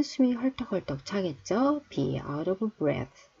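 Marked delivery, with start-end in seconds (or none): 1.9-3.3 very slow, each word drawn out; slow teacher demo; slow model reading. Shown as a demonstration. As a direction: spell it out slowly for learners. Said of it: "teacher models phrase slowly".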